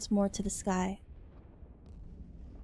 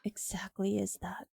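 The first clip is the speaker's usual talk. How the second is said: soft unvoiced question; whispering; 9 dB quieter than usual. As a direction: breathe the question out. Matching a whispered game line.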